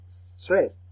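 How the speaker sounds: teacher highlighting the word "Stressed"; emphasized term. Shown as a demonstration. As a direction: punch "Stressed" as a key term. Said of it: "This is something called stressed".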